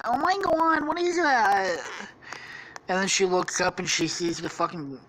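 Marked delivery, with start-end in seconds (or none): 0.0-1.8 put-on very high girly voice, aghast; high-pitched mock alarm; alarmed Daphne. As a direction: play a startled cartoon damsel. Daphne hears the monster roar.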